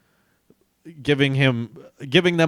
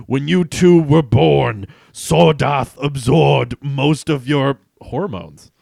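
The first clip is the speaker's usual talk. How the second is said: 1 explaining villain; 3 semitones higher, 9 dB louder, slower than usual. Acted. Conrox explaining.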